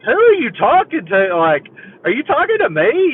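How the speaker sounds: high-pitched, incredulous imitation; startled wife. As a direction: ask as if baffled by the outburst. Wife startled by his yelling.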